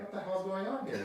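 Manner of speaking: high, indignant divine voice; God peering down, startled. Acted.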